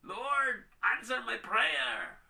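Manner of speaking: high, loud, slow mock demand; impatient believers demanding the Lord answer, high and loud. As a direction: demand an answer right now. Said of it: "Gusto natin kasi".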